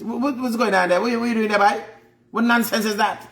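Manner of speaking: high, sharp motherly scolding; high-pitched Caribbean mum telling off her son. Retold or imitated. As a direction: play the mother catching her boy out.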